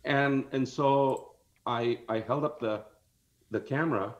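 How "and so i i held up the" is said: ordinary delivery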